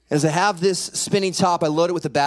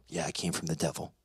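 very soft, low and quick; a chastened kid's subdued answer; sheepish reply; 13 semitones lower, 13 dB quieter, faster than usual. Retold or imitated. Son admits the lie's source to dad, subdued.

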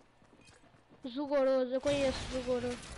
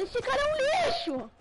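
much higher, loud, slow shout; angry outburst; 10 semitones higher, 4 dB louder, slower than usual. Real, not acted. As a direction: yell it at the screen in frustration. Angry at opponent in game.

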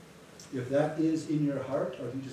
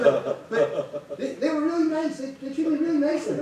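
high, loud, laughing excitement; excited friend; 11 semitones higher, 6 dB louder than usual. Retold or imitated. Pastor voicing his delighted friend describing the officials, high and laughing.

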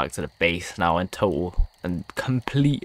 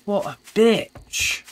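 pissed off; 7 semitones higher, slower than usual. Real, not acted. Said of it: "I'm so pissed off".

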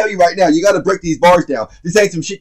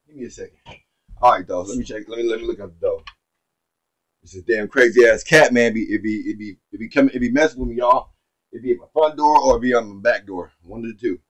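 much lower and softer, off-mic muttering; checking his sugar away from the mic; 10 semitones lower, 8 dB quieter, faster than usual. Real, not acted. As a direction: talk from across the room. Steps away for diabetes check.

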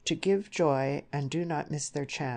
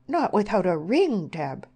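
loud, raised cry of protest; horrified; 4 semitones higher, 7 dB louder than usual. A narrator's reading. Mother objecting to her daughter living unmarried, horrified. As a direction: protest in horror.

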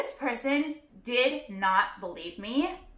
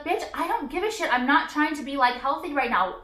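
ordinary talk

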